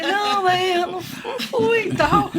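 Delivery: high, laughing, sheepish excuse; mock your own flustered denial, laughing; embarrassed laugh. Scolded in Bahia for never parading, laughing sheepishly. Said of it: "Eu falei".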